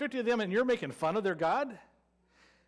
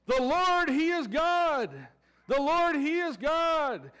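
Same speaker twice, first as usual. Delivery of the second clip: higher, louder, slow proclaiming cry; 5 semitones higher, 3 dB louder, slower than usual. Retold or imitated. People on the mountain crying out, loud slow proclamation. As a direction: shout it out like an awed crowd. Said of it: "they're crying".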